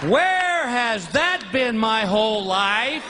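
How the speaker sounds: slow, shouted delight; ecstatic. Acted. Praising Vicodin to the crowd, slow ecstatic shout. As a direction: marvel at it like a revelation.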